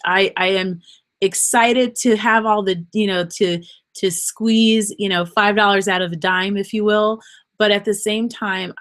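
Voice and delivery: ordinary delivery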